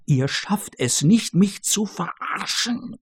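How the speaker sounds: trying to shout but no sound comes; a scared man's strangled hush; voiceless hushed protest. Acted. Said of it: "will er rufen, doch das Stimmband lässt keinen Laut".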